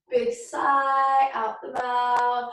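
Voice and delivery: drawn out slowly, easing the sigh; slow breathing cue. Shown as a demonstration. Teacher guiding the class's exhale, slow and drawn out. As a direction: stretch the words like the exhale.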